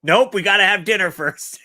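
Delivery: high, loud bossy refusal; mock parent. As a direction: lay down the rule like a parent. Listener voicing parents.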